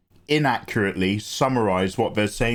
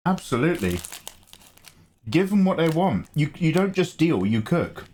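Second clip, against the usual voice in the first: ordinary delivery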